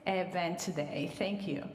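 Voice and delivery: usual speaking voice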